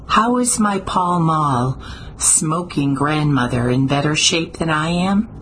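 ordinary delivery

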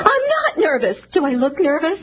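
high-pitched, rattled protest; nervous denial. Acted. Woman denying her nerves to needling man, flustered. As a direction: deny it too hard, voice jumping up. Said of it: "I should say so".

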